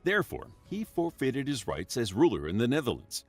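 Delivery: ordinary talk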